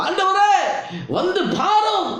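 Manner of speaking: high, drawn-out mock wail; mourners' plaintive cry. Imitating mourners calling Jesus to the tomb, plaintive cry. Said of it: "ஆனா இவங்க சொல்ற".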